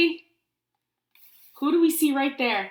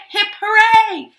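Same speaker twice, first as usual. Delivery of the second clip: loud, high, drawn-out hooray; big celebratory shout; birthday cheer; 10 semitones higher, 13 dB louder, slower than usual. Real, not acted. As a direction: lead the class in a birthday cheer.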